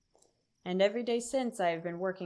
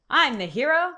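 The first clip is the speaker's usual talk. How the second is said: loud proud declaration; boastful shout; 4 semitones higher, 7 dB louder than usual. Acted. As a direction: proclaim yourself the hero.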